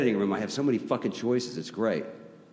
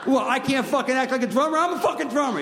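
much higher, laughing rant; laughing mock indignation; 12 semitones higher than usual. Drummer friend insisting, higher laughing indignation. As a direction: huff it through laughter. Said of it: "I'd be like".

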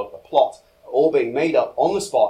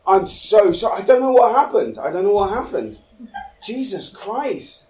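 higher, louder, stammering apology in a rush; flustered panic; 6 semitones higher, 3 dB louder than usual. Acted. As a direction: gush apologies, tripping over the words. Panicked apology for wet bed.

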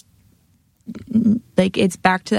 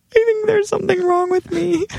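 high, small worried confession; mock-distressed high voice; retold distress; 10 semitones higher than usual. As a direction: admit it to a friend, voice pitched way up. Retold shaky text to friend.

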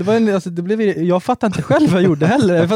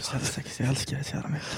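whisper; 13 dB quieter, faster than usual. Pretend. Mocking bedroom talk.

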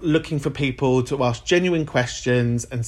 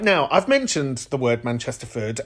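ordinary delivery

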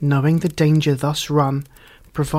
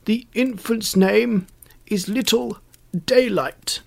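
high, carefully projected, mild shout; shouting in her ear; 6 semitones higher than usual. A narrator's reading. Archbishop tells the deaf fairy the name, mild high-pitched shout. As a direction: call it out for someone hard of hearing. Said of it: "stooping to shout in her ear".

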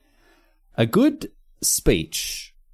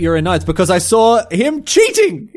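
mock official; 8 semitones higher, 5 dB louder than usual. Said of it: "Be like".

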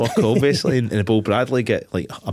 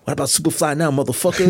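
higher, lightly acted movie taunt; mock gloating gangster; 6 semitones higher than usual. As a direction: crow over a rival, imitating a film villain. Voicing gloating gangster from the film.

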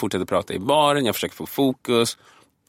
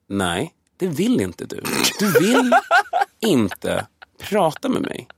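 slow menacing warning; 6 semitones higher, much slower than usual. Retold or imitated. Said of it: "min röst är ett instrument".

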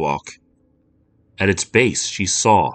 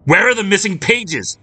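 much higher, louder furious bellow; angry man bellowing at a child; 13 semitones higher, 4 dB louder than usual. Acted. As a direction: roar the demand at the girl. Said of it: "he bellowed".

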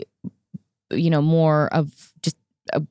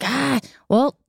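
eager reply; 7 semitones higher than usual. Retold or imitated. Quoting herself answering Melissa.

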